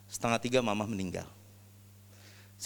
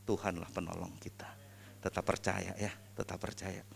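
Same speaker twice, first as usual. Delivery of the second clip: soft whispered affirmation; 6 semitones lower, 11 dB quieter than usual. Real, not acted. Encouraging listeners in faith, whispered. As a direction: breathe it out as a whisper. Emotional testimony, closing encouragement.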